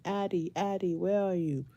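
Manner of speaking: normal talking voice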